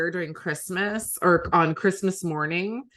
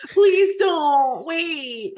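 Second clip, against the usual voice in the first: snotty crying; 7 semitones higher, 5 dB louder, much slower than usual. Real, not acted. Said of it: "I really ugly cry".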